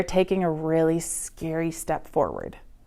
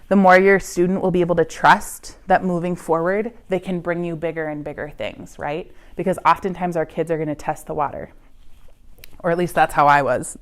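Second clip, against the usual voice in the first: ordinary talk, nothing marked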